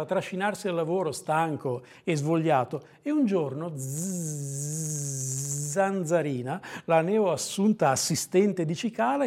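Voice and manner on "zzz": long, low imitated buzz; mosquito buzz. A narrator's reading. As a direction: draw out a soft mosquito zzz. Narrator buzzes as Zanzarina enters.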